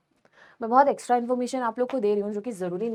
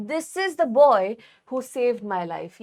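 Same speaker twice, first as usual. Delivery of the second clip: slow dictation; much slower than usual. Shown as a demonstration. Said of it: "example sentence".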